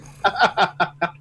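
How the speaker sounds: high, loud laugh; laughter. Real, not acted. Streamer amused by the Spanish nickname, laughing. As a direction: burst out laughing.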